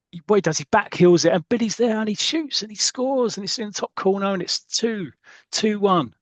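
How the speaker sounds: excited commentary. Retold or imitated. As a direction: call the goal like a football commentator. Retelling match climax like commentary.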